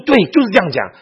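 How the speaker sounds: high, loud burst of recognition; acted-out excited agreement; sudden realization. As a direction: exclaim as if it just clicked. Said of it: "我突然亮了".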